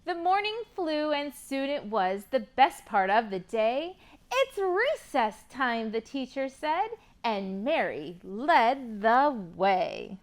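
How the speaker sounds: rhyming picture book read with lively lilt; reading to children. Acted. Teacher reading a rhyming book to kids, lively. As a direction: read the rhymes to a class of kids.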